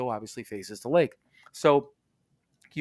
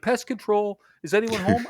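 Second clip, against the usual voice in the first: calling out; 8 semitones higher than usual. Retold or imitated. Voicing the exterminator calling out.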